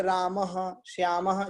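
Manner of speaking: रामः and श्यामः ending in an echoed "-aha"; visarga endings. Shown as a demonstration. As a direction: sound the h and echo vowel in रामः, श्यामः. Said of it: "ये जो ऐसा pronunciation है". (रामः, श्यामः के अंत में "अह")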